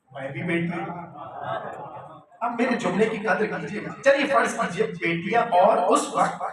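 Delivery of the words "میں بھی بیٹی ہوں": a hint of a woman protesting, much softer; lightly voiced daughter, murmured low; imitating a daughter's claim. Preacher voicing a daughter claiming her share, soft and low. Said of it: "وہی کھڑی ہو کے کہتی".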